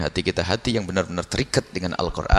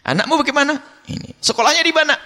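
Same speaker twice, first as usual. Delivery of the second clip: stern questioning voice; 3 dB louder than usual. Pretend. God questioning the man.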